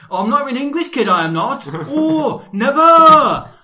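high, loud sing-song Welsh voice; mock Welshman. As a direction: ham up a Welsh lilt. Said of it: "the Welshman going".

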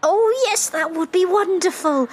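high, eager delight; gushing excitement. Acted. Excited fan offered a DNA test by guide, thrilled.

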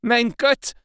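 sudden high startled gasp of words, half swallowed; a cry choked back in the throat; shocked exclamation. A narrator's reading. Professor recoiling from the dying girl, stifled shocked cry. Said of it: "I could hear his ejaculation".

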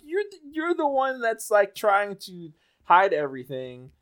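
high-pitched outrage at the mom; outraged. Real, not acted. Ranting about the cheer mom, high outraged disbelief.

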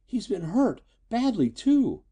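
high, dismayed daughter's voice; distressed wail. A narrator's reading. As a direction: lament it high, voice lifted in worry. Distressed daughter over injured father.

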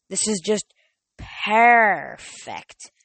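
gloating villain, stretching out "perfect". A narrator's reading. Manticore has ambushed the heroes on the dock, gloating. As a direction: relish it, swelling on the last word. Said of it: "the Manticore gloated".

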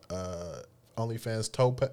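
ordinary talk, nothing marked

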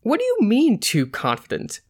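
high, indignant start that drops back; acted offended question; real accent, out of character. A narrator's reading. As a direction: drop the act and challenge the note. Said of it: "she said in her real accent".

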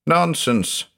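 high-pitched, mildly snappy dismissal; snapped. A narrator's reading. Doctor dismissing the judge's dread, with a snap. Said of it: "I snapped".

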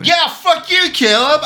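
high, loud mock outburst; mock angry yell. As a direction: fake fury at a friend. Mock fight on request.

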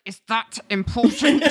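tense retort forced through gritted teeth. Retold or imitated. Witness answering John's question about her age, through gritted teeth. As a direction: say it with the teeth clenched, barely holding back. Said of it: "through gritted teeth".

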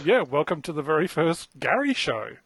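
ordinary delivery

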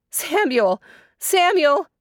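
high call, climbing on the repeat; storybook cry for help. A narrator's reading. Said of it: "she cried out".

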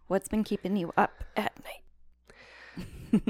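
slow breathy whisper; spooky whisper. Acted. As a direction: whisper it slowly, eerie. Spooky podcast question.

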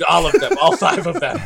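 loud laughing insistence; laughing emphatic. Real, not acted.

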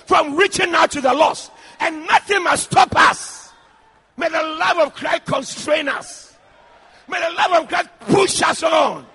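loud, rhythmic pulpit declarations; fervent exhortation over the congregation; full-force preaching. Real, not acted. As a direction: build a prayer of blessing to a ringing climax.

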